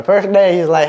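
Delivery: normal talking voice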